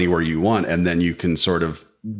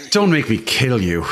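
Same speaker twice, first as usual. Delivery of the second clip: put-on Irish accent; 4 semitones higher than usual. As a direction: lay on a thick Irish accent. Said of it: "With a great Irish accent".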